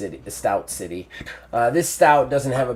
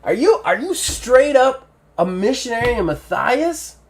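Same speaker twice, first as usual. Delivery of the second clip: much higher, incredulous stout questioning; stout NPC voice; 13 semitones higher, 4 dB louder than usual. Acted. Stout asks the missionary if he's truly one, high and incredulous. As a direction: squeak out the question in disbelief. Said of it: "It's like".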